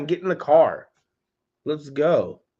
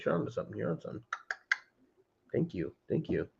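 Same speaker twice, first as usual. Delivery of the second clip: ordinary talk, nothing marked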